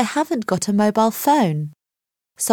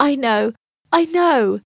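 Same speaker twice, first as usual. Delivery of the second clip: higher-pitched, understated tearful voice; crying; 7 semitones higher than usual. A narrator's reading. Becky comforts her crying best friend, tearful. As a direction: comfort through a touch of tears. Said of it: "says Becky, crying".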